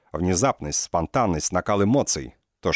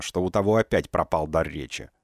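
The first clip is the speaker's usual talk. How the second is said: ordinary delivery, nothing marked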